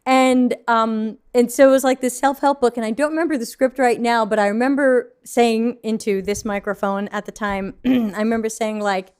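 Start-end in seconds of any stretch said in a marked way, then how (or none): none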